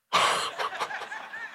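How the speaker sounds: high, breath-caught gasp; acted gasp of disbelief; stunned gasp. Pastor left alone after unexpected praise, stunned gasp.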